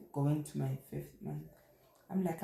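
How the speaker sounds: usual speaking voice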